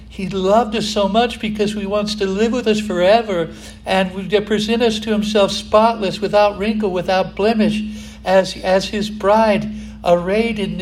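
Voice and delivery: loud, high fervent preaching; rising fervent sermon delivery; preaching at full force. Acted. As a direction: preach it out to the whole church. Preacher builds to full force again.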